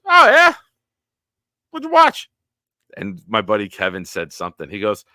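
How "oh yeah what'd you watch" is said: much higher, loud sing-song dad; fake-friendly dad setting a trap; mocking dad. Friend's dad teasing the boys in the elevator, high sing-song.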